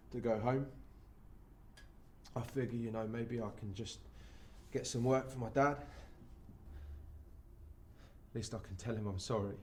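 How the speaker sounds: low, soft, halting regret; subdued and sorry, with long pauses; remorseful confession. Acted. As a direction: confess it quietly, ashamed. Broken son plans his apology.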